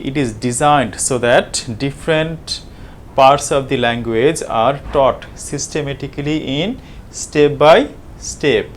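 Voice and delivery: deliberate, very slow reading of English text; slow reading aloud. Acted. Lecturer reads the English syllabus text to students, slow dictation. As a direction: read each word out as if dictating.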